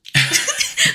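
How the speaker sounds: high airy laugh; quick high giggle; breathy giggle. Real, not acted. Laughing at Fat Man joke.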